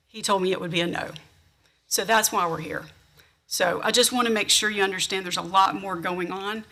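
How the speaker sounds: usual speaking voice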